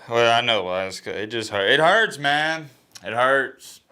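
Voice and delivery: pained, rising high on the repeat; upset and close to tears; real emotional hurt. Real, not acted. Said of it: "Why am I getting emotional right now?".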